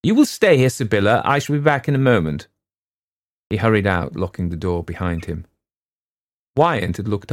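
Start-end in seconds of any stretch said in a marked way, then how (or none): 0.0-2.5 louder, higher stern order; commanding father. A narrator's reading. Father ordering Sibylla to stay, stern. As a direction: give a firm order.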